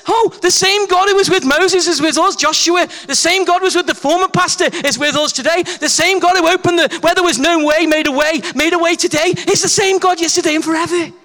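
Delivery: high, loud jubilant praise; triumphant proclamation; excited praise. Retold or imitated. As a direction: rejoice like the team did. Team rejoicing retold.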